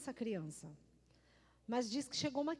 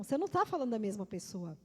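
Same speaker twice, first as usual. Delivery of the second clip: normal talking voice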